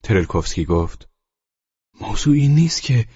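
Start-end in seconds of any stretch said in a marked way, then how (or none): 2.0-3.1 high, loud protest cut short; tenant's voice. Acted. Tenant protesting to the landlord, cut off. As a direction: try to object and get cut off.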